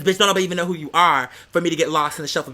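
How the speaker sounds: ordinary talk, nothing marked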